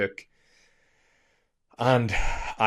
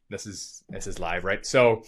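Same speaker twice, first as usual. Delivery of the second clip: ordinary talk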